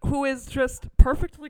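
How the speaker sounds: overwhelmed fan swooning; gushing. Real, not acted. Gushing over Rick Moranis.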